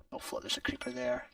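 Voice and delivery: whispered in alarm at a creeper; spotting a creeper, whispered aside; hushed whisper. Real, not acted. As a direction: drop to a quick whisper. Sudden creeper nearby in game.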